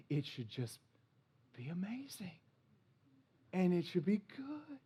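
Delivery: breathy hushed, fading to a whisper; dreamy whisper. Retold or imitated. Pastor mocks the dream of the perfect match in a whisper. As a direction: whisper it like a starry-eyed fantasy. Mocking romantic belief.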